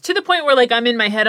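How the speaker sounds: usual speaking voice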